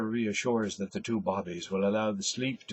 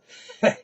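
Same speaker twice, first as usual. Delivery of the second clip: short airless chuckle; a quick laugh under the breath; breathy laugh; 9 dB louder than usual. Acted. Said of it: "He laughs".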